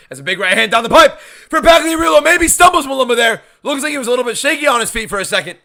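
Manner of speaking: loud, high ringside call; excited commentary. Real, not acted. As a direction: yell out the landed shot. Live play-by-play excitement.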